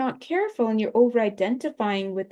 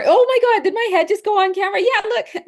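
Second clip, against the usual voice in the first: sudden high loud gasp of surprise; startled surprise; 10 semitones higher, 6 dB louder, faster than usual. Real, not acted. She spots her own head on camera, surprised. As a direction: caught off guard, voice jumping up high and loud.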